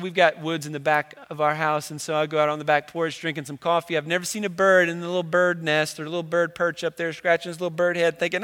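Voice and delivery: ordinary talk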